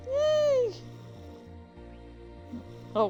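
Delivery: plain reading voice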